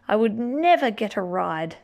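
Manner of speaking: plain reading voice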